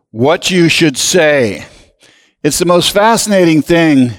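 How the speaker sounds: ordinary talk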